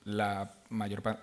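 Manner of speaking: usual speaking voice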